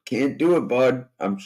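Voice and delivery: ordinary talk, nothing marked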